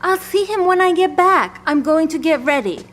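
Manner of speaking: firm insistence. Acted. Evelyn insists on going outside.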